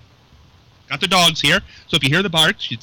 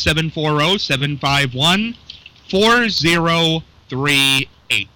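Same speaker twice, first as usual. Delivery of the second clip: slow, careful repeat of the number; slow and deliberate; slower than usual. Real, not acted. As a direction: spell out each digit slowly for listeners. Repeating phone number slowly.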